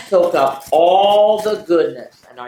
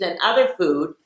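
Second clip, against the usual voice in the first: plain reading voice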